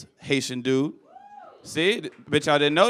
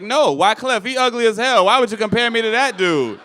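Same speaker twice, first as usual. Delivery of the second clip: louder, higher protest; mock indignation; 7 semitones higher, 6 dB louder than usual. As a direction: push back, offended. Indignant retort.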